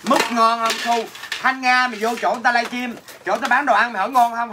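ordinary talk

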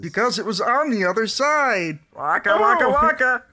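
high, loud goofy bear punchline; Fozzie voice. Acted. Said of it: "I'll be Fozzie".

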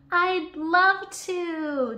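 high, slow, drawn-out happy cry; a delighted child's slow yelp; cheerful scream. A narrator's reading. Jenny answering Chip, slow cheerful high cry. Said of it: "Jenny screams cheerfully".

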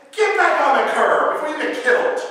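loud urgent yell; shouted warning. Retold or imitated. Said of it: "and he says".

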